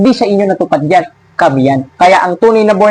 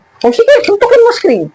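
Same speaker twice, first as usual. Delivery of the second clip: high-pitched goading jeer; taunting; 12 semitones higher than usual. Real, not acted. Preacher daring an angry rival pastor to hit the screen, high taunting. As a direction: goad them in a much higher voice.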